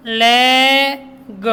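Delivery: लेग stretched, final ग split off; sounded out. Shown as a demonstration. Teacher spelling LEG for pupils, लेग slowly sounded out. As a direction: hold "leh" in लेग, then a separate "guh". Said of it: "ऐसे एलईजी को".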